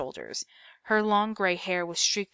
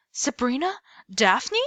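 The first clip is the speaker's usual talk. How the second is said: higher, louder cry with a hint of German accent; 6 semitones higher, 6 dB louder than usual. A narrator's reading. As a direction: greet them in a light German accent. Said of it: "cried with a hint of German accent".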